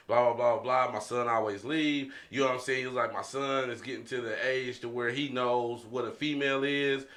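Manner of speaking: usual speaking voice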